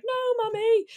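put-on high little whiny child voice; high, plaintive toddler whine; mock pleading child. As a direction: imitate a small boy protesting to mum. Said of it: "he's like".

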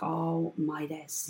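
soft, very slow, stunned; stunned quiet. Retold or imitated. Mother shaken by the diagnosis, soft and slow. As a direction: barely get it out, shaken. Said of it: "her eyes shot water".

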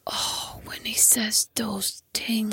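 slow, hushed, drawn-out creepy imitation; imitated film line. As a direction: mimic the actor in a breathy whisper, very slowly. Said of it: "Do it".

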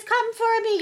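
very high-pitched mock royal voice; squeaky caricature of a monarch; the Queen. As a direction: put on a high, regal lady's voice. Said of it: "I'm really good at doing the Queen".

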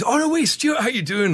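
high-pitched acted hello; mock enthusiastic greeting. Musician spotting Stuart at catering, re-enacted eager greeting. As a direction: greet a friend, pitched well up.

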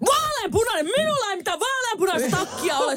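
very high, outraged imitation; hammed-up indignant squawk; mock verbal attack. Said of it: "semmonen hyökkäys sieltä niinku".

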